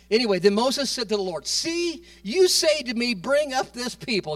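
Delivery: loud, high, impassioned sermon voice; fervent preacher pleading Moses's case; preaching at full force. Acted. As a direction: preach it out, building, almost shouting.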